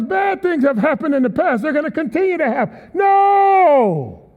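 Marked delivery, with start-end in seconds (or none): 2.9-4.3 high, loud rebuking No; a preacher's thundering refusal; emphatic shout. Real, not acted. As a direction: shout it down hard. Preacher rebukes victim thinking.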